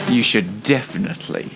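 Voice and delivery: normal talking voice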